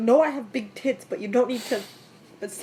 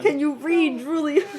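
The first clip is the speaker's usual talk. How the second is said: higher, louder scolding; exasperated; 4 semitones higher, 4 dB louder, slower than usual. Real, not acted. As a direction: demand it with raised voice. Mock scolding co-reader mid-game.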